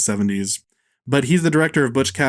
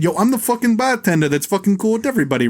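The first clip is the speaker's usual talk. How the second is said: a lightly voiced tough-guy bartender, higher; mock the bro bartender's swagger; cliched bartender; 7 semitones higher than usual. Host mocking Cannavale's cliched cool bartender, lightly voiced. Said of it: "playing just the most cliched".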